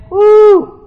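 high, loud woo; hammed-up holler of pain; mock pain whoop. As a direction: yelp as if cramped. Acting out leg cramp pain.

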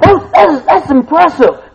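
high, loud, amazed exclaim; excited. Retold or imitated. Preacher retelling his own thought, high and amazed. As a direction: gush in wonder. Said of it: "I thought".

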